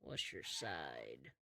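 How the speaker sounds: very low, slow, quiet waiter. Acted. Waiter asks sheriff for a side, deep and slow. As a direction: drop deep and draw it out softly. Game master voices the waiter.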